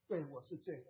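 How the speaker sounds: soft, low concession; subdued, humble admission; sheepish admission. Retold or imitated. Sheepishly accepts the accusation.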